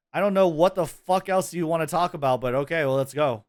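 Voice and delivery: usual speaking voice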